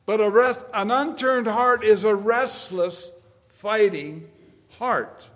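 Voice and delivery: slow, loud, high preacher's cry; booming sermon delivery; full force preaching. Acted. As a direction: hammer each line home to the congregation. Preaching climax.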